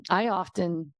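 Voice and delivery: strained, failing voice; losing voice. Real, not acted. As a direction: voice cracking, going hoarse. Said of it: "I'm losing my voice trying to speak this".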